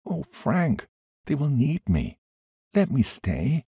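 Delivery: high, urgent pleading. A narrator's reading. Woman begging Frank to let her stay, pleading high. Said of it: "I pleaded".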